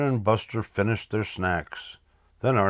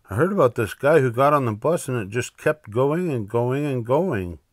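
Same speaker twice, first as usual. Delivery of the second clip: put-on higher, animated kid telling a rumour; worried boy Buster; 5 semitones higher, 3 dB louder than usual. Buster warning Arthur about the runaway bus, worried. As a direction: tell an alarming tale in a lively, higher voice.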